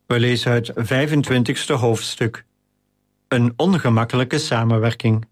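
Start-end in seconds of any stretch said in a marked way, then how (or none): none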